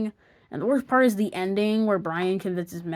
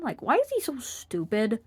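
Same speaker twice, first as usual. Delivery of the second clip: ordinary delivery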